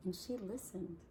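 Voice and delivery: plain reading voice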